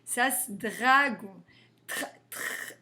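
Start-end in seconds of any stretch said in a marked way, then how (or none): 1.9-2.7 "tr" breathed without voice; voiceless tr. Shown as a demonstration. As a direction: hiss out "tr".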